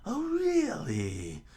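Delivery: high, loud, drawn-out villain voice; squealed sarcastic disbelief; Buggy, laughing taunt. Acted. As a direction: stretch it out with mocking glee. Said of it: "Buggy said with a loud laughter".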